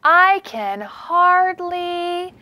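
slow, lively picture-book read; storybook voice for young kids; reading to children. Acted. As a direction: read the big book to the class. Reads big book aloud.

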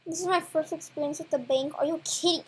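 normal talking voice

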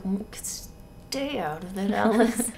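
very soft, slow brooding reply; low warning. Acted. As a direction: drag it out low under the breath. Edward curtly warns Alice.

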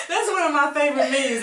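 loud high laughter into words; laughing. Real, not acted. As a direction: crack up over the meme. Laughing about favorite meme.

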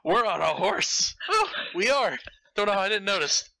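mock surprise. Mocking Jessica's horse line.

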